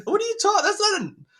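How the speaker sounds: very high-pitched mock indignation; flustered mock protest. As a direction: sputter in comic disbelief, voice way up. Dad replays his flustered protest.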